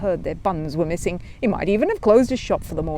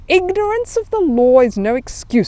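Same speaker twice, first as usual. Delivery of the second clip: stern; 6 semitones higher, 7 dB louder than usual. Acted. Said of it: "said the policeman sternly".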